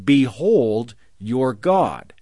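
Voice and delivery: slow, solemn preacher's proclamation; preaching voice. Shown as a demonstration. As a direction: proclaim it slowly from the pulpit. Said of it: "supposed to be preaching".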